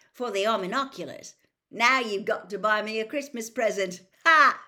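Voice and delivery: fast, loud, gleeful jab ending in a laugh; excited teasing. A narrator's reading. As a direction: rib your friend cheekily. Ron teasing Harry, excited.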